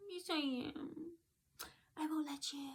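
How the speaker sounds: high, soft, droning self-important officer; mocking dim officer. Retelling the officer claiming to be the law, mocking. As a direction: mimic her in a quiet monotone, pitched high. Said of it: "she's talking like this".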